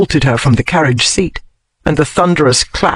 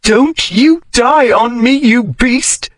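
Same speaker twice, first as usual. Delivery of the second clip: high, slow choked cry; high tearful scolding; choked plea; 7 semitones higher, slower than usual. A narrator's reading. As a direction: force it past a tight throat. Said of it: "she choked past the burning thing".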